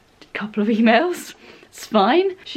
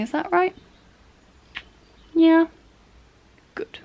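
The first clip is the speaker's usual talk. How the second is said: high, sweet-talking voice; 7 semitones higher than usual. Real, not acted. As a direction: coo it to a little companion. Coaxing a snuggle companion for help.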